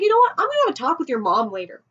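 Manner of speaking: put-on mom snaps at the boy; mom exploding. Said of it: "And she's like".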